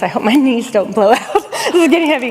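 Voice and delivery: real chuckle breaking into the words; laughing aside. Real, not acted. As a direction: say it through a laugh, voice lifting. Laughs at own aside mid-talk.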